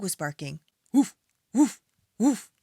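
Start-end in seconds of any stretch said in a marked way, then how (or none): none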